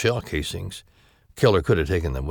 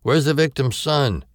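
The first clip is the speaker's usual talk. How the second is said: higher, louder call across the house; 10 semitones higher, 6 dB louder than usual. Acted. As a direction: call out urgently from below. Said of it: "David called from somewhere".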